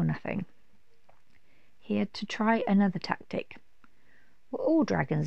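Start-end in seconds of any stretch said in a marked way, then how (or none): none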